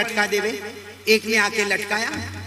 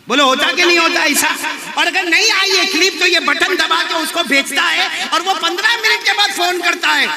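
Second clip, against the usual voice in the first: raised, insistent sermon voice; forceful preaching; 8 semitones higher, 7 dB louder than usual. Real, not acted. Preacher challenging listeners about shared clips, forceful. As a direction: press the crowd hard for agreement.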